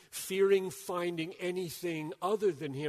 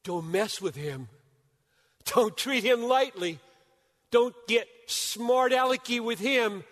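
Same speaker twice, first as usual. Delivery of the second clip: higher, louder, driving fast on the key point; full-force preaching; 9 semitones higher, 10 dB louder than usual. Acted. Preacher firing up the congregation, loud and high. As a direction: preach it hard, building through the list.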